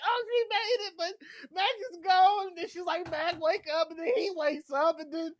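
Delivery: high, teary voice breaking; crying while recapping. Real, not acted. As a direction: talk through real tears. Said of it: "and then I break down".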